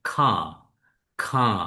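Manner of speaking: "খাঁ" said with no r, long open vowel; British pronunciation. Shown as a demonstration. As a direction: demo "খাঁ" the British way without r. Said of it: "র উচ্চারণ করবে না".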